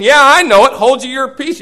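loud and high, brushing them off; mock annoyed shout at pestering prophets; exasperated retort. Elisha to the nagging prophets, loud exasperated retort. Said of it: "he kept saying".

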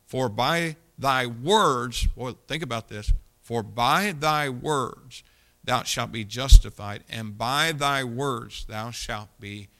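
very slow, weighty reading of the verse; slow scripture reading. Acted. Preacher lingers slowly over the verse. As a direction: drag every word out for emphasis.

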